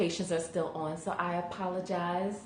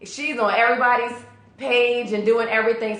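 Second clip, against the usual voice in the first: impressed exclaim; 6 semitones higher, 9 dB louder than usual. Retold or imitated. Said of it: "I'm like".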